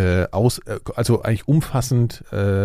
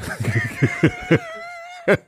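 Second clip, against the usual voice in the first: high laugh. Real, not acted. Laughs at joke.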